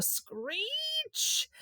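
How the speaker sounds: high, drawn-out screech; siren screech. A narrator's reading. Narrator imitates the siren, a high drawn-out screech. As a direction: hold the word like a wailing siren. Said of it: "The siren wound slowly up".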